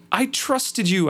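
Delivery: high-pitched accusing outcry; crying out. A narrator's reading. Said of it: "I cried out".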